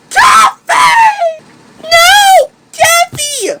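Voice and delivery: anguished shout. Acted. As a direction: shout in horror. Sees Kathy in danger.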